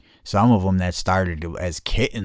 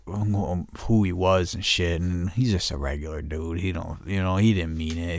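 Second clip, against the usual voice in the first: ordinary talk, nothing marked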